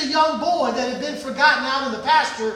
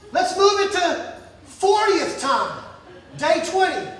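usual speaking voice

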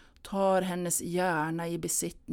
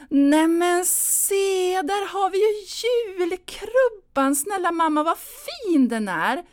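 much higher, louder and slow, overplayed delight; forced excited call; 13 semitones higher, 9 dB louder than usual. Anna distracting her mother with the crib: slow, high, loud cheer. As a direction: cry out in desperate cheer.

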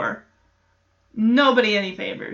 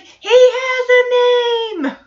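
imitating Michelle; 13 semitones higher, 6 dB louder, much slower than usual. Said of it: "Michelle's like".

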